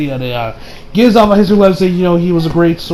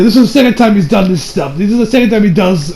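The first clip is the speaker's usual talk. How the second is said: ordinary talk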